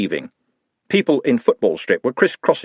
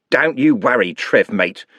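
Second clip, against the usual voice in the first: plain reading voice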